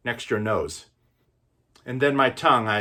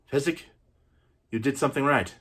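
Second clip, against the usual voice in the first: ordinary talk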